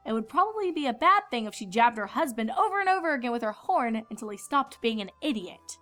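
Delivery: ordinary delivery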